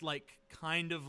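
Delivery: ordinary delivery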